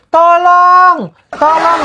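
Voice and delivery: calling tolong out high and loud; shouted help call. Shown as a demonstration. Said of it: "begini sebutannya". (teriakan minta tolong)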